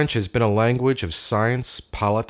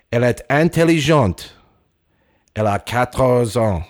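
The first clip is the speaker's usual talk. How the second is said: louder, measured language-tape reading; old language tape voice; solemn taped voice; 3 semitones higher, 5 dB louder than usual. Acted. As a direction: recite like an old classroom recording. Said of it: "a solemn male voice from the past".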